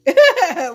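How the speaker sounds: loud, high burst of laughter; real laugh. Real, not acted. Storyteller cracking up over the coming fight, real laugh.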